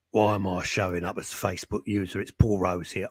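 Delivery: low put-on EastEnders voice; low-pitched EastEnders impression; Paul Rose. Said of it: "I just thought I'd do that voice".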